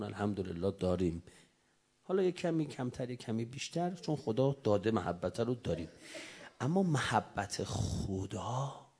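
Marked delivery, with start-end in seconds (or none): none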